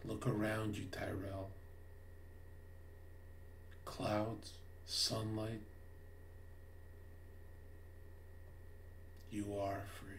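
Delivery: very soft, low, spacious delivery; hushed, soothing revelation; calm therapist. Acted. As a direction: guide them softly, with long pauses. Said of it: "and I go like this".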